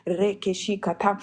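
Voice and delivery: ordinary delivery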